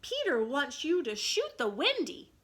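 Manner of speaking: ringing fairy reply. Acted. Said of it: "Tink's reply rang out".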